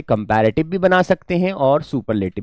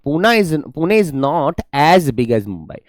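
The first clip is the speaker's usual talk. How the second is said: very slow dictation of the corrected sentence; slow classroom dictation; much slower than usual. Shown as a demonstration. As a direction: read the corrected sentence slowly for copying.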